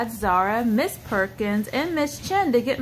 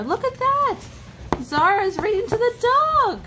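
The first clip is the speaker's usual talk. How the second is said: excited; 10 semitones higher than usual. Real, not acted. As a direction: point it out with big delight. Parent shows toddler the picture book.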